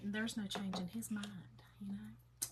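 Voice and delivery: soft whispered aside about her son. Real, not acted. Confiding to viewers about her middle son, in a whisper. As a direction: drop to a whisper for the confiding bit.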